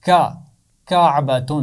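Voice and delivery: کا then کعبۃ, the k stressed; sound then word. Shown as a demonstration. Teacher sounds out کعبۃ for the children. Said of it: "پہلی آواز حرف کاف کی آواز ہے".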